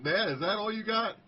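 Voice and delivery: upbeat and loud, with a smile; smiling greeting. Retold or imitated. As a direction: ask it brightly through a smile. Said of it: "looked at him and smiled and said".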